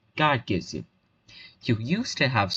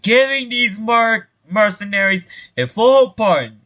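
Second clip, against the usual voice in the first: grand proclamation; 8 semitones higher, 5 dB louder than usual. Acted. Mayor announces a pardon to the town, grand proclamation.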